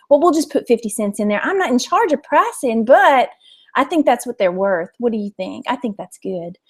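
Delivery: a sweet Southern lady settling the price; East Texas accent. Pretend. Shop lady telling the vlogger the price, light East Texas accent. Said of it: "I'll do the rest of the watches in her voice".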